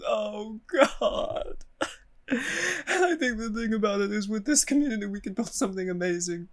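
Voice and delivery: high, tearful laughing breakdown; laughing while crying. Real, not acted. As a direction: laugh through tears. Laughing-crying breakdown over community collapse.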